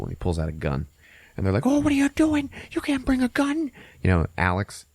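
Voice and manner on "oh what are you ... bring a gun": very high, squeaky protest; hammed-up falsetto alarm; panicked nerdy guy. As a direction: nerd objecting in a squeal. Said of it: "who's, like, the nerdy guy".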